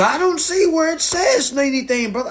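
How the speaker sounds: high, loud mocking imitation of a confused reader; mocking whiny reader. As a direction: squeak it out like a clueless complainer.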